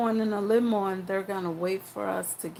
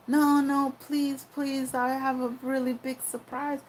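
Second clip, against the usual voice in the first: put-on pleading boyfriend; 6 semitones higher than usual. Boyfriend begging her to go.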